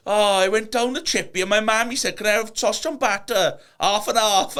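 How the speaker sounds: high, loud hammed-up valleys chippy order; broad Welsh accent. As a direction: mimic a valleys local ordering, laughing. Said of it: "they're like".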